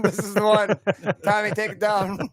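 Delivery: mock tree picker. Tree picker ordering Tommy to fell it, mock command. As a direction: call it out like a pretend foreman.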